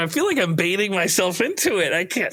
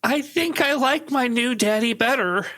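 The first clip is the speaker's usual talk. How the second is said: put-on high, slow little-kid voice; neighbor's kid; 8 semitones higher than usual. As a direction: play a small child siding with the new dad. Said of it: "Now the neighbor's kid is over here".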